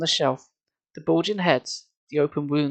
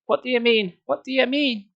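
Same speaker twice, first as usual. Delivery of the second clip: high frantic questions; 9 semitones higher than usual. Acted. Grabbing his shirt.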